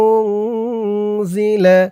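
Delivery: উংজিলা slow, নুন held as a nasal hum; nasal ghunna. Shown as a demonstration. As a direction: hold the ng of উংজিলা through the nose. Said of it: "ইকফা গুন্না". (উংজিলা গুন্না টেনে)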